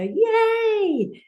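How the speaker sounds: long, high yay; runner's victory cheer. Retold or imitated. Runner at the end of the race, imitated victory cheer. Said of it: "runners often do at the end of the race".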